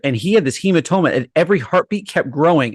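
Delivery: ordinary talk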